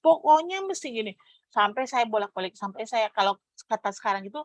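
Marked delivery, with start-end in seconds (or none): 0.0-1.1 high, loud no-arguing refusal; insistent refusal. Retold or imitated. Insisting to her husband she needs the money now, high and loud. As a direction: shut the husband down, no room to bargain. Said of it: "Saya bilang".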